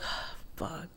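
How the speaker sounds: whispered, exhaled curse; frustrated aside. Retold or imitated. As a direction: retell it as a hushed sigh of frustration. Retold frustrated reaction.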